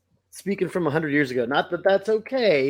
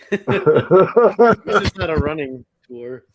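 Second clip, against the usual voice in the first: slow laughing quip, much louder; 6 dB louder, much slower than usual. Real, not acted. Co-host laughing at Bart's prize joke, slow and louder.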